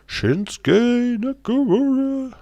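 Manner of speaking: drawn-out, high, loud announcer call; ring announcer. Pretend. Streamer mimicking an entrance announcer for chat, drawn out and loud. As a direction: belt out the name like a ring announcer.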